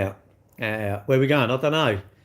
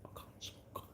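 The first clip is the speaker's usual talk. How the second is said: whispered, barely audible; scared whisper; 29 dB quieter than usual. Retold or imitated. Shy online tough guy meeting someone in real life, whispering. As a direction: shrink away and breathe the words.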